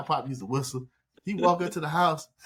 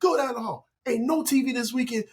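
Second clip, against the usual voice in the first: high-pitched mock-father tirade; heated comic imitation of dad; scolding dad; 9 semitones higher than usual. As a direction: lay down the punishment like an angry dad. Angry dad gives punishment.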